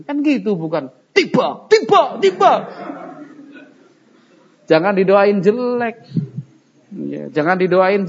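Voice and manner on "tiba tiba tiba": high-pitched hammed-up cursing cries; acted outburst at a reckless rider; mock angry curse. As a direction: jab out each word like a comic curse. Acts out cursing a rider to fall.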